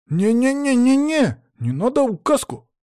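very high, stuttered flurry of refusals; panicked boy. A narrator's reading. Pupil alarmed by the pointer threat, panicked. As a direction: squeal the protest in alarm. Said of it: "тут же всполошился Саня".

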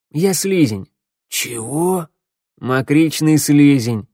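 drawled mocking creature voice; gruff mockery. A narrator's reading. Gang of monsters bickering, mocking.

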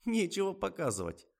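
catty, snide remark. A narrator's reading. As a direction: needle him snidely. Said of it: "ехидно пояснила Лиза".

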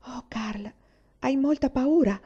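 a hushed, breathy whisper rising to worry. A narrator's reading. Una sneaking out to Carl at night, whispering. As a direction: whisper so nobody hears.

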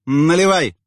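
loud barked order; commanding shout. A narrator's reading. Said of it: "скомандовала она".

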